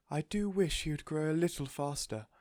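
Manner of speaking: high, wistful voice; doting lady. A narrator's reading. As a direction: sigh a fond wish.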